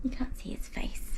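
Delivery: whispered aside; soft whisper while holding the dog. Real, not acted. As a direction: say it under your breath.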